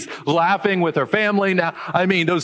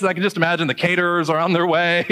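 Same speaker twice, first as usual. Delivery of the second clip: ordinary talk, nothing marked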